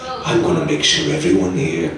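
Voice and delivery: slow, menacing villain threat; low menace, drawn out slowly; sadistic villain. Shown as a demonstration. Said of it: "the sadistic villain laugh".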